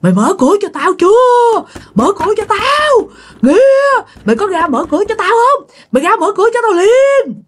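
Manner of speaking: very high-pitched, demanding yell. Acted. Angry voice outside orders the woman to open the door, yelling high. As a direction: yell shrilly, each order rising to a held high cry. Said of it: "la lên".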